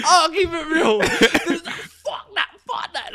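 high laughing outburst; cracking up while talking. Real, not acted. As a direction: laugh through the words. Laughing retelling.

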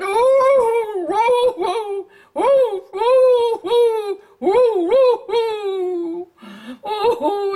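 high, loud whimpering howl of a sobbing dog; a sad dog's crying howls; puppy crying. Acted. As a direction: wail like a heartbroken little dog. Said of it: "he's crying so hard".

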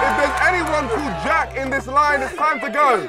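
high, projected crowd address; calling out. Real, not acted. Host addresses waiting line outside restaurant, calling out. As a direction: carry it over a whole queue.

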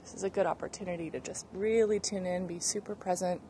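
calm breathing guide voice; guided breathing. Acted. Leads breathing warm-up.

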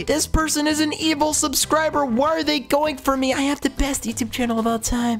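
high indignant whine; being chased. Real, not acted. Chased by a subscriber.

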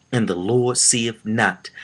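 ordinary delivery

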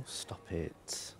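normal talking voice

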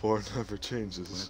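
narrator voice. Retold or imitated. Quoting the game's narrator.

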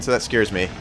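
usual speaking voice

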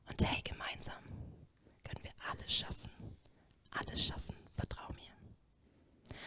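soft intimate whisper, fading to almost nothing. Acted. God whispering his promises to Christina, tender whisper. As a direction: breathe the promise close to her ear. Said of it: "flüstert Gott".

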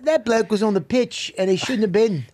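put-on British football talk; British accent. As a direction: complain as a mock British fan. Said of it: "All right, go British".

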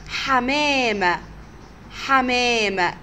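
put-on drawn-out حمامة for children; slow clear word. Shown as a demonstration. Teacher to young pupils, slow modelling. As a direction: stretch حمامة slowly, each sound clear. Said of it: "teacher models word slowly".

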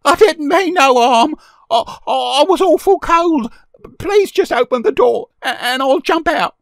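put-on very high, loud pleading boy; scared child begging, loud and high; frightened sob. A narrator's reading. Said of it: "said with a frightened sob".

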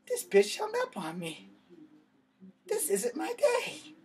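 can't believe she hung up; stunned disbelief. Retold or imitated. Stunned after hang-up.

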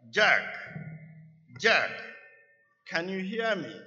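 loud call from above. A narrator's reading. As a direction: call his name from afar. Voice calls down to Jack.

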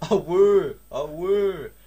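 loud high wailing siren "a woo"; alarm siren imitation. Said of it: "Went the alarm".

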